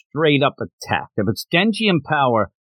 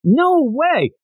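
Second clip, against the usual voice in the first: shocked protest; much slower than usual. Retold or imitated. Denji protests being promised away, shocked yelp. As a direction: yelp in disbelief at the deal. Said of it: "Denji's like".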